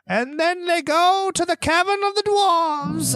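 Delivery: high, slow, far-off imitation of the audiobook narrator. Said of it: "he's like".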